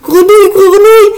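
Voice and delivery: loud, very high horrified outcry; despairing cry. A narrator's reading. Marguerite seeing her frog face, despairing. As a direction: cry out in alarm. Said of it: "s'écria-t-elle avec désespoir".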